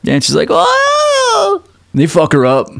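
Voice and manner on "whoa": very high squealing whoa; mock shocked yelp. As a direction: shriek in fake alarm. Stepmom attacked by dolls.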